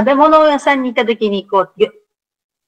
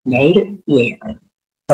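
very slow repeat; 7 semitones lower, much slower than usual. Shown as a demonstration. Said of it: "learner repeats slowly".

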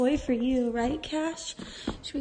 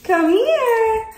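calling the dog; 7 semitones higher, 6 dB louder than usual. Real, not acted.

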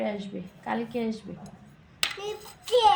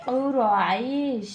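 slow recitation; much slower than usual. Shown as a demonstration. Teacher models recitation slowly.

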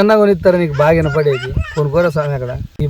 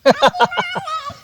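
high squealing laugh. Acted.

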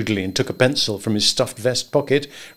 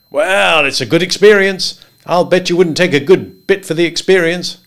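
higher, louder, bluff and jovial; higher voiced good old boy; hearty Henry; 6 semitones higher, 6 dB louder than usual. A narrator's reading. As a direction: boom friendly advice across the desk.

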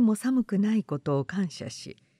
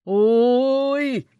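drawn-out call, loud and higher; long shout; 4 semitones higher, 7 dB louder, much slower than usual. A narrator's reading. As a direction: call out across the ruins. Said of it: "叫ぶのが聞こえた".

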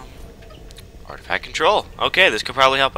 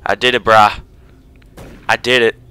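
normal talking voice

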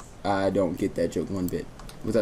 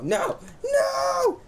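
panicked shout. Real, not acted. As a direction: cry out in real alarm. Losing the diamonds to friend.

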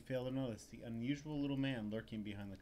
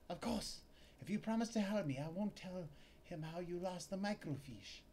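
high scheming female voice; high female clerk, sly; 4 semitones higher than usual. Acted. Clerk bargaining with Larry in a high sly voice. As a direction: float the bargain, high and coy.

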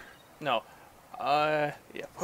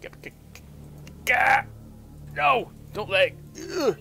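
startled stammer into a high yell; panicked shriek at a sudden attack; scared yelp; 7 semitones higher, 5 dB louder than usual. Real, not acted. Fish attacks player.

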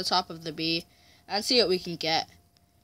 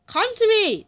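calling out; 13 semitones higher, 7 dB louder than usual. Real, not acted. Calling an opponent over.

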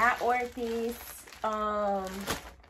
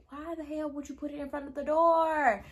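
higher, exasperated mock complaint; retold exasperation; 4 semitones higher than usual. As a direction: act out disbelief at the delivery lady. Retold outburst at the delivery lady.

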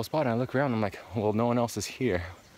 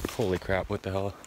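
normal talking voice

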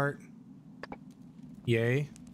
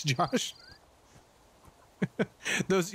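ordinary talk, nothing marked

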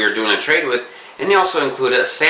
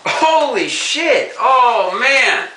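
thrilled outburst; 14 semitones higher, slower than usual. Real, not acted. Opening a package from Tom, bursting with excitement. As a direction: burst out in real joy at the gift.

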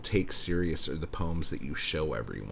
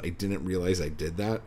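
ordinary delivery

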